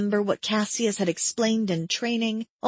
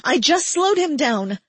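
higher and louder pleading denial; desperate protest; 7 semitones higher, 5 dB louder than usual. A narrator's reading. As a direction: insist it, raised and desperate.

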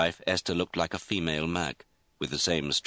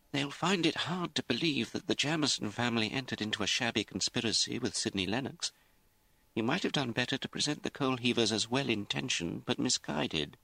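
higher, softly reasoning lawyer; lightly voiced lawyer; 7 semitones higher, 3 dB quieter than usual. Lawyer tells his client how it looks, lightly voiced, measured counsel. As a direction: counsel the client in a raised pitch.

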